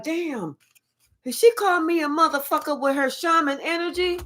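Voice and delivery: high, incredulous gasp of a question; mock shocked reaction from the people told off; stunned disbelief. People stunned at being called names, incredulous.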